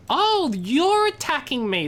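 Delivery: high, drawn-out playful protest; mock indignation. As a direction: act wounded by a teasing jab, slowly swooping high. Playful protest at a viewer's teasing.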